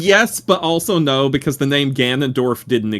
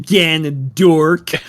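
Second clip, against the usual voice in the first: drawn-out mocking Ganondork; hammy villain taunt; 4 semitones higher, 4 dB louder, much slower than usual. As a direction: stretch Ganondork out slowly and loud. Hammy villain taunt, name stretched.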